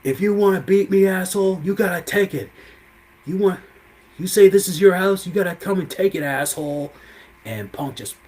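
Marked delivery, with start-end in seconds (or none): 0.0-6.9 higher, louder snarling dare; taunting challenge. Retold or imitated. Rollins daring Punk to take the win, loud high taunt. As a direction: throw down the challenge, voice raised. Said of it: "Rollins was like".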